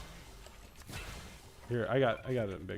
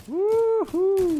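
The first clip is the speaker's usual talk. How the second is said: cheering; 11 dB louder than usual. Real, not acted. Cheering a teammate's kill.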